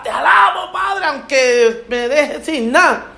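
high, drawn-out cry to God; fervent praise. Acted. Believer praising Father despite losing everything, fervent cry. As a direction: call out praise, slowing down.

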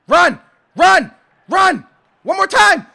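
loud, high commands; urging shout. Real, not acted. Gamer urges his player to run, urgent shouting. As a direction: yell each order in a short burst.

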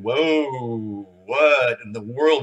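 imitated amazed "whoa", long and drawn out; slow mock astonishment; astonished onlooker. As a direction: step back and marvel, slowly. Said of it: "you're going".

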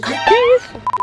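sudden high startled cry. Real, not acted. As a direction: jump at a noise mid-sentence. Startled by a noise. (susto repentino)

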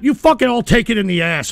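ordinary talk

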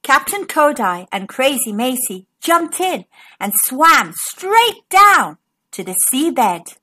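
plain reading voice

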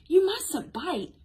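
high, slow admonishment; slow, emphatic telling-off for small children; storybook scolding. A narrator's reading. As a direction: gently scold with drawn-out high words. Ducky gently scolds Billy Goat.

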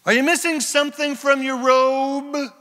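high, loud taunting call; acted yell. David calling down to Saul about his robe, yelling. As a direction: holler the question across a distance. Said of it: "he yells down".

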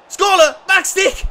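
high, loud call across the room; urgent fan call. Real, not acted. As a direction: shout the instruction at the screen. Fan calling out a cross.

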